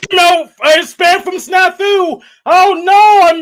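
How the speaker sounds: high, exaggerated mock dismay; childish mock alarm. Caller taunting the host about spam email, childish mock alarm. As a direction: wail it in hammed-up panic. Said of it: "you act like a fucking child".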